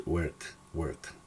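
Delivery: "work" softly, with an E vowel, like "werk"; correct pronunciation. Shown as a demonstration. Teacher settles on the right vowel, "work" pronounced with E. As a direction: land softly on "work" with E, not O. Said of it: "no, wo".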